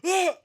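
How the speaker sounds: short, high, loud yelp; sudden high-pitched shriek of surprise; startled mock scream. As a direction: yelp as if caught out. Acting out startled man seeing her.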